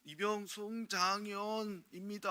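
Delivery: slow, high recruit's report with 입니다 drawn out; 어눌한 신병처럼 '이병 송장현입니다' 를 천천히 높게; korean military style. Retold or imitated. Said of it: "신고를 해요". (느리고 높은 신병 관등성명)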